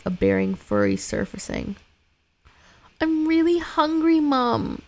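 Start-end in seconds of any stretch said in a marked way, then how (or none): 3.0-4.8 put-on much higher, louder whine of a hungry kid; whiny little boy. Hungry son whining to his exhausted mother, high little boy. Voices young son Samuel.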